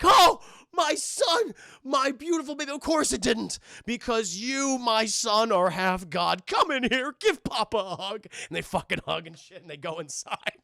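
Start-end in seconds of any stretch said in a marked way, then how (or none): 0.0-8.3 loud, high, gushing proud papa; booming, theatrical fatherly delight; overjoyed father. Retold or imitated. God welcomes his long-lost son, overjoyed.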